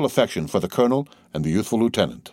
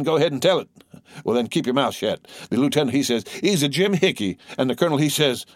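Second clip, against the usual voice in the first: higher-pitched, eager soldier's yarn; excited young soldier storyteller; 7 semitones higher than usual. A narrator's reading. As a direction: relay thrilling news to comrades. Soldier telling colonel and lieutenant's talk.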